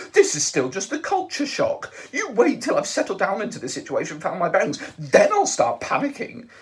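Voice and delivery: fretful Englishman holding it together; lightly voiced Arthur. A narrator's reading. Arthur insists it's only culture shock, rattled.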